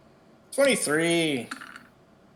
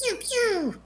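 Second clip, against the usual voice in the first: high-pitched blaster noises; playful pew-pew. Pretend. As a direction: mimic little laser shots, way up high. Voicing her crossbow and blast shots.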